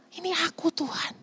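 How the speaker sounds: soft unvoiced whisper; whispering to calm frightened friends; whispered reassurance. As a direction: hush them gently, breath only. Jesus gently reassuring disciples.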